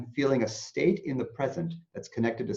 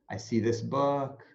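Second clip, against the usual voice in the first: normal talking voice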